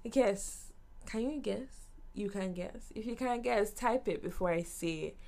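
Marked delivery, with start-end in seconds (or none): none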